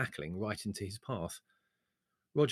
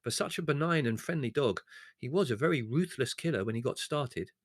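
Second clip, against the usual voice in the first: ordinary delivery, nothing marked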